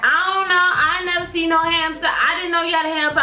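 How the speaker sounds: high-pitched mimicked kid playing innocent; child's denial. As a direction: imitate a kid protesting innocence. Acting out Lucy denying everything.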